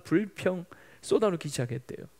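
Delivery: ordinary delivery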